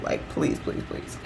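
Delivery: voiceless, hushed mock begging; fading into a pleading whisper. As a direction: whisper it like you're begging a friend. Retells begging friend to reschedule.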